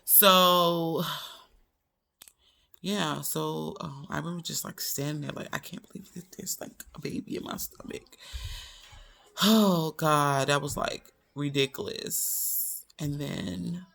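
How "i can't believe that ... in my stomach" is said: voiceless, quieter, awed disbelief; a girl whispering her shock to herself; stunned whisper. Retold or imitated. Stunned thought at the desk.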